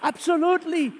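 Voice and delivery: shouting for joy in New York; excited yell. Retold or imitated. Telling the doctor, a joyful shouted yes. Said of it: "I started yelling in New York".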